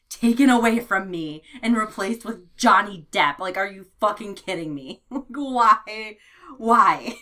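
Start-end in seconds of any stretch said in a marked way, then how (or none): 5.1-7.2 laughing. Real, not acted. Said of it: "laughs in disbelief".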